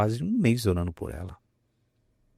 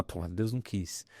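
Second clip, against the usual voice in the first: mildly deflated, softer and slower; resigned; 11 dB quieter, slower than usual. Acted. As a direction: let it drop quietly, as if giving up. Imagined Naaman giving up.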